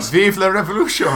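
shouted French, laughing; French accent. Pretend. To the lads, mock French rallying cry. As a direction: yell it like a French revolutionary. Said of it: "That was a very good French accent".

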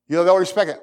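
ordinary talk

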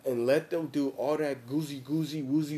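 ordinary talk, nothing marked

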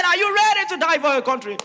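loud high commander's cry; army commander rallying his soldiers loudly; rallying shout. Acted. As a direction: rouse the troops before battle. Commander asks again, louder.

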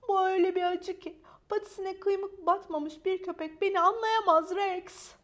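high, loud, martyred complaint; melodramatic suffering. Acted. Maya tells Rex he cannot understand, high melodramatic suffering. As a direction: insist nobody understands your pain. Maya dramatizes her pain.

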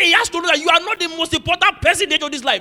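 loud, high, outraged preaching; furious retold rant. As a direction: relive the rage at the workers' meeting. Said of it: "I was most furious".

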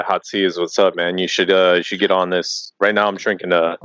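usual speaking voice

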